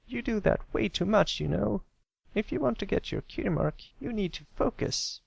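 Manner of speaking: high, sharp scolding filly voice; snapping filly. Acted. Scootaloo snapping at Pipsqueak for spacing out, high and sharp. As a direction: tell him off and walk away. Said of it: "she snapped".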